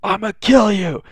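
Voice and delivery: high, strained mock-furious yell; hammed-up outrage, pitched high without full volume; Bakugo. Pissed classmate raging at being outscored, mock yelling. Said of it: "now Bakugo's pissed".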